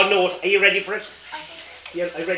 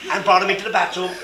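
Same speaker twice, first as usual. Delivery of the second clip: ordinary delivery, nothing marked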